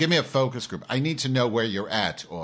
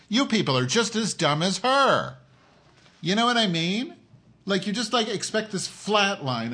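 angry rant; 8 semitones higher than usual. Real, not acted. Ranting at his audience.

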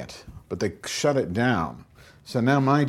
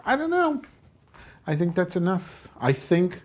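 squeaky silly voice; 11 semitones higher than usual. Pretend. Squeaky goofing voice.

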